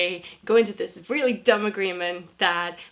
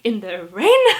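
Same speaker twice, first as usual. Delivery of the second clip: higher, louder mock rant; freaking out; 4 semitones higher, 6 dB louder than usual. Customer rants at the cast member about his ticket, higher and louder. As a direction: rant like a furious grown man. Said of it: "he starts freaking out".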